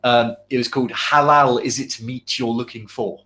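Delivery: ordinary talk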